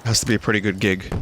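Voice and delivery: ordinary delivery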